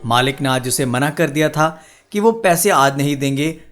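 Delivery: usual speaking voice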